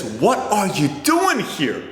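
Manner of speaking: astonished question. Retold or imitated. Manager finding Patrick waiting outside, slow and amazed. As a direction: stretch it out in disbelief.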